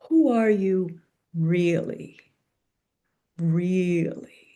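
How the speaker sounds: slow, weighty questioning with a hint of low baritone; measured, solemn imitation of a mentor; Thurman's gravitas. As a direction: lightly voice a grave old preacher pressing the question. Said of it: "imagine that in Doctor Thurman's baritone and gravitas".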